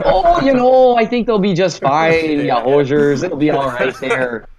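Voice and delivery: put-on folksy Minnesota accent, drawn-out and high; hammed-up Minnesota yokel accent, slow. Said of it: "my Minnesota accent making me sound like a complete rube".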